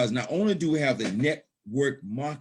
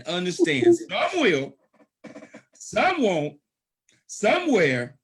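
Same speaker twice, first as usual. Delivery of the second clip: climbing higher and louder on each phrase; rising preacher fervor; 9 semitones higher, 5 dB louder, slower than usual. Real, not acted. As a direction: build it like a sermon peak. Preacher building to a climax.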